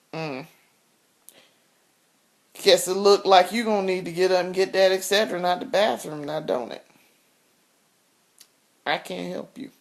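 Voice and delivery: a hint of a flat, cold husband, low and soft; cold dismissive husband. Husband refusing to fetch pills for wife, coldly dismissive. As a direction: hand down his refusal lazily, no care at all. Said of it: "I'm going to demonstrate exactly what he said".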